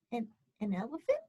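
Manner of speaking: ordinary talk, nothing marked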